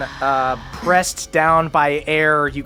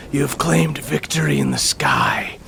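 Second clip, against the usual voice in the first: whispering; 5 semitones lower than usual. Acted. Queen addresses the champions.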